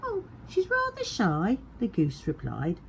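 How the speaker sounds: very high, sly goose voice; high coy goose. A narrator's reading. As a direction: fib sweetly like a goose. Goose replied.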